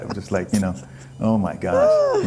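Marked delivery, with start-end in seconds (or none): 1.2-2.2 much higher and slow, stretched in disbelief; drawn-out oh my gosh. Retold or imitated. Telling host about parents, slow astonished exclaim. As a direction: let it hang in amazement. Reacting to parents not understanding.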